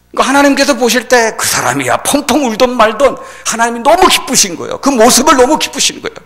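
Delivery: high, loud sermon delivery; impassioned preaching. Acted. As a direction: press each phrase out forcefully. Preaching at full force. (열정적으로 외치는 설교)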